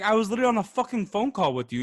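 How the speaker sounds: indignant protest. Retold or imitated. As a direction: rattle it off high, as if arguing back. Retelling his argument on the phone.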